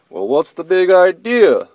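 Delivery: sleepy grumpy bear. Acted. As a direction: woken bear demanding answers. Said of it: "the sleepy voice said".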